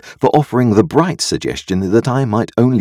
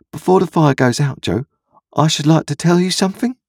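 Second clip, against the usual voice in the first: put-on high, earnest child's voice; young Pip confessing; 11 semitones higher than usual. A narrator's reading. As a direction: lift the pitch like a boy with something to admit.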